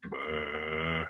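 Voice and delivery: long, low, soft blah; loose, floppy low voice. Shown as a demonstration. Teacher showing floppy vocal cords, low slack blah. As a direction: let the blah sag like a loose rubber band. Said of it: "really loose and floppy vocal cords".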